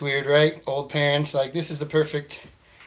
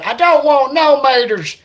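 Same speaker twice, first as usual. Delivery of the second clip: high, loud, very slow twangy drawl; mock hillbilly ordering through the speaker; 11 semitones higher, 10 dB louder, slower than usual. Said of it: "redneck guy".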